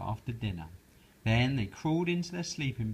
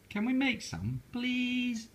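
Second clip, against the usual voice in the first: much higher begging; pleading Froggy; 12 semitones higher, slower than usual. Acted. Froggy begging mother for popcorn, pleading. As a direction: wheedle sweetly.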